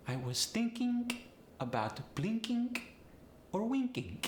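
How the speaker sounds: Polish accent, -ing ending in a hard K; high, phrase-by-phrase Polish accent demo. Shown as a demonstration. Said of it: "I N G goes to the I N K".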